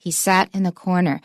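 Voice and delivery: "in" slipped lightly between "sat" and "corner"; natural model, "in" weak and unaccented; no stress on "in". Shown as a demonstration. Teacher illustrating weak prepositions, unstressed "in". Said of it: "一般的介系词是不会有重音的".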